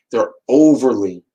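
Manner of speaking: "overly" drawn out slow and loud; slow, heavy "overly" with a falling end; stress on overly. Shown as a demonstration. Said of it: "I had overly in front of dramatic".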